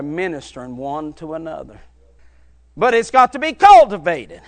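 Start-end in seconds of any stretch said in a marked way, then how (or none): 2.8-4.4 loud, rising sermon cadence, punching each phrase; preaching at full force. Acted. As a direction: preach it to the back pews.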